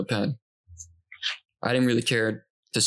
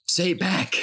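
needy roommate; 8 semitones higher, slower than usual. Retold or imitated. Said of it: "He said".

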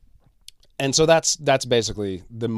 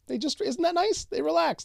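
much higher mock-sweet sing-song; sarcastic sweetness; 3 dB quieter than usual. Mocking relaxed throat muscles to listeners, sarcastic sweetness. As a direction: coo it with fake delight.

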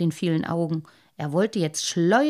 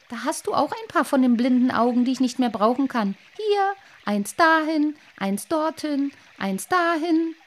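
quick, higher gloating fish voice; 6 semitones higher, 4 dB louder than usual. Acted. Lamprey in the dream planting eyes on the boy, gloating. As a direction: gloat as you stick the eyes on.

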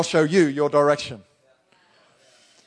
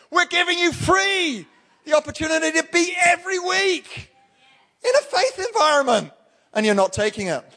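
exasperated shout; 11 semitones higher than usual. Retold or imitated. Pastor mock-shouting at church skippers, exasperated. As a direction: pitch it way up, half laughing at them.

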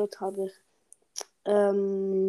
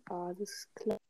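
whisper; 4 semitones lower, 10 dB quieter than usual. Real, not acted. Halt deinen Mund.

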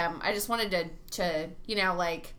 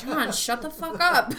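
laughing through the words; giggling while snapping at a friend; 3 semitones higher, 4 dB louder than usual. Retold or imitated. Laughing, telling friend to stop.

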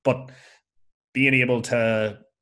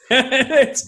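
laughing; 14 semitones higher, 9 dB louder than usual. Real, not acted. Laughing at own joke mid-sentence.

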